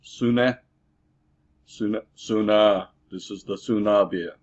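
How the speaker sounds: trying the beer's name with a vaguely Russian accent; foreign-sounding name, drifting into Russian accent; attempted Russian accent. Shown as a demonstration. Drinker guessing at a German name, slipping into Russian accent. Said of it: "Maybe that was my Russian accent".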